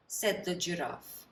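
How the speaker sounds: plain reading voice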